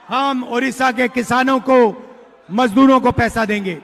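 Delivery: ordinary talk, nothing marked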